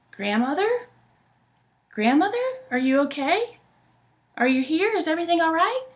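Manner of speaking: Little Red calling anxiously for grandmother through the door; worried Little Red. Acted. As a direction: call into the house, a little worried.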